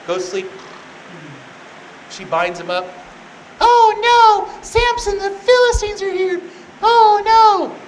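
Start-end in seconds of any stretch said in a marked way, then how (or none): 3.6-7.7 very high, loud singsong damsel cry; mock alarmed yell. Delilah warning Samson a second time, mock alarm. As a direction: call out in exaggerated fake panic.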